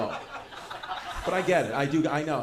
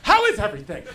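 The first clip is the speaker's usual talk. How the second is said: mom's voice, pitched up and louder; imitating mom, high and loud; 10 semitones higher, 8 dB louder than usual. Comic telling mom asks, higher and louder. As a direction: play the worried mother, up high. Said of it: "She's like".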